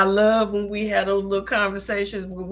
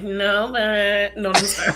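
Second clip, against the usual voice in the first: whiny voice; 3 dB louder than usual. Shown as a demonstration. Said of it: "You gotta do it with the whiny voice".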